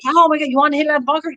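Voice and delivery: rapid, high, eager question; fast excited question. Retold or imitated. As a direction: rush it out with delight. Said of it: "Gary's like".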